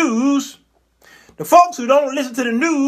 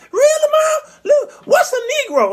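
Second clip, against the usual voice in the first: put-on very high, squeaky kid voice; loud falsetto kid asking mama; naive child; 4 dB louder than usual. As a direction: pipe up like a puzzled little child. Acts out child questioning mother.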